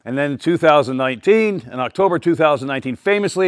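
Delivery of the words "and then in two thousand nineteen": usual speaking voice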